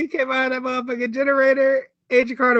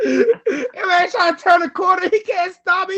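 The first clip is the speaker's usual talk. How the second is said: usual speaking voice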